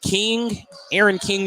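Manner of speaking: ordinary delivery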